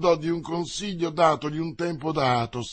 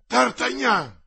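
surprised cry; 8 semitones higher, 5 dB louder, slower than usual. Acted. Athos recognises D'Artagnan.

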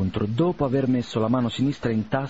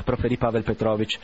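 plain reading voice

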